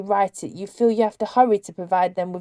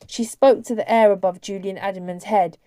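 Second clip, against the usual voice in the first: usual speaking voice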